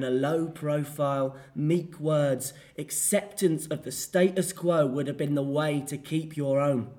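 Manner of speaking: an actor scolding Medea for her wild tongue; dramatic monologue. Acted.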